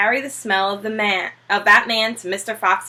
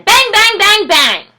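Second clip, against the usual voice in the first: much higher, louder bang bang; mock gunshots; 11 semitones higher, 9 dB louder than usual. Bean acting out the ambush, loud mock bangs. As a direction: punch each bang like a shot.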